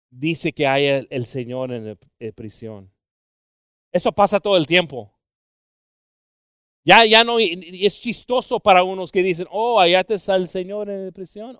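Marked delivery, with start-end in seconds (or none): 3.9-11.6 loud, high preaching; impassioned preaching. Acted. Preacher telling the brother's story to the church, impassioned. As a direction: drive the testimony home.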